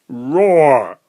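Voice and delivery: loud imitated bear roar; hammed-up loud roar. A narrator's reading. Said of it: "roared the bear".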